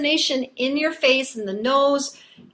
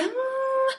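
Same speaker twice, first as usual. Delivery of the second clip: high airy "mm" with little buzz, softer; airy hum; 12 semitones higher, 5 dB quieter than usual. Shown as a demonstration. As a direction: show the breathy hum students fall back to. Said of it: "super airy sound".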